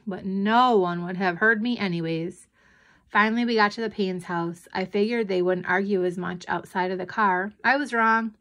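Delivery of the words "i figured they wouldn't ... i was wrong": usual speaking voice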